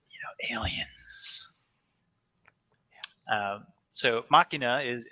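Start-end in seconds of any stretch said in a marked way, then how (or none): 0.1-1.5 secretive whispered aside. Acted. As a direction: hush it like a secret. Conspiratorial aside about faction name.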